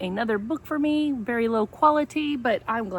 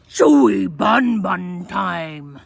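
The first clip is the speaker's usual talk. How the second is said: louder, slow hungry beast cry; dinosaur roar; 7 dB louder, slower than usual. A narrator's reading. Dinosaurs rushing in, slow loud hungry cry. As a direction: bellow it slowly like a greedy monster. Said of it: "trio of dinosaurs yelling".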